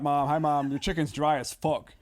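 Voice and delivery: normal talking voice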